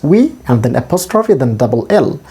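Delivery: ordinary talk, nothing marked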